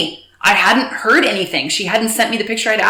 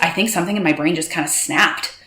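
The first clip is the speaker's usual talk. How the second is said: usual speaking voice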